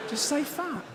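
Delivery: high, exasperated demand. Real, not acted. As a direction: throw it out in exasperation. Exasperated outburst at euphemisms.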